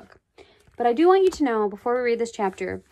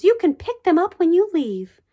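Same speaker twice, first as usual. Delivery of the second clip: a higher, firm teacher voice; stern teacher; 7 semitones higher, 6 dB louder than usual. A narrator's reading. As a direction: scold the pupil as a strict teacher. Teacher scolding a rude pupil.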